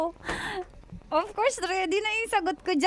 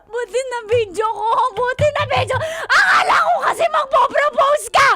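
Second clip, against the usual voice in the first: crying, building to high shouts; hurt, rising outburst; tearful fury; 5 semitones higher, 10 dB louder than usual. Real, not acted. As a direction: shout accusations through tears. Betrayed partner breaks down shouting.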